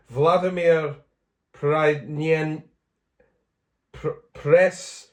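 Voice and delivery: "Premyen-" broken off, then stuttering into "Press"; retry the name and stall on "Pr-, Press"; halting pronunciation attempt. Real, not acted. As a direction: sound out "Vladimir Premyen-" in halting pieces. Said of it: "Shall I try that again?".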